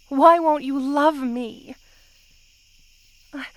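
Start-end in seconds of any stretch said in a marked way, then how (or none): 0.1-1.7 slow, louder and higher, a hurt cry; an anguished, pleading demand; desperate plea. Acted. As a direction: beg to be loved. Pleading with beloved.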